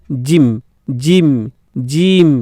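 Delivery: "জিম" with a brief i; short i. Shown as a demonstration. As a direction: model "জিম" as separate words, short vowel. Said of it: "অল্প স্বর উচ্চারণ হচ্ছে".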